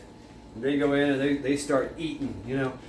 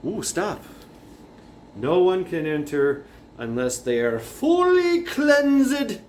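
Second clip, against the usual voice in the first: put-on solemn old guard; 5 semitones higher, 4 dB louder, slower than usual. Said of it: "and he's like".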